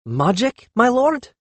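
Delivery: high, innocent questioning lilt; ingenuous. A narrator's reading. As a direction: feign wide-eyed innocence. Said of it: "Haklatus repeated ingenuously".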